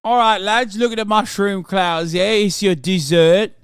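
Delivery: loud, high, hammed-up British accent; rally the lads in cartoon British; mock British officer. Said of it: "They're like".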